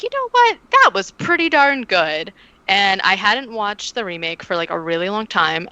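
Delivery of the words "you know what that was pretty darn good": pleasantly surprised. Retold or imitated. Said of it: "I left being like".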